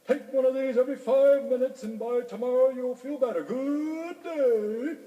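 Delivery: high, loud rooster doctor; roost-side manner. A narrator's reading. Rooster doctor handing corn to Orley, high bedside manner. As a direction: put on a high-pitched, brisk bird doctor. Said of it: "in his best roost side manner".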